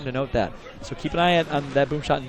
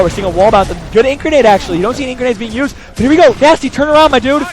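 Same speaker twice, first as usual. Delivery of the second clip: excited shout; 9 semitones higher, 10 dB louder than usual. Real, not acted. Caster calls a tense fight.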